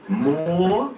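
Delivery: echoing the note. Retold or imitated. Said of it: "he said".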